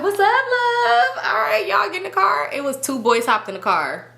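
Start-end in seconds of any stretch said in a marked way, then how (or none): 0.0-2.5 very high, drawn-out hail; mock excited greeting. Friend hailing boys into the car, high-pitched imitation. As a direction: call out a gushing hello, imitated.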